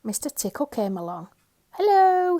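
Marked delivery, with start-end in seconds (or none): 1.7-2.4 cheery hello. Acted. Mister Tickle greets.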